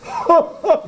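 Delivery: high gleeful chortling laugh; joyful chortle. Acted. Old father chortling at his son's victory, high laugh. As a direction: chortle with delight.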